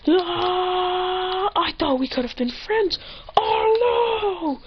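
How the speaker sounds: high, wailing mock anguish; hammed-up betrayal. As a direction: wail it like a betrayed anime hero. Betrayed character cries out.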